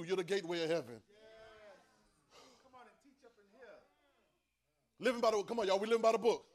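loud, high preaching; preaching at full force. Acted. Preacher lifting the congregation with scripture. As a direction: proclaim it to the room.

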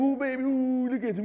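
put-on lightly cooing high baby voice; baby talk. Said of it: "and you".

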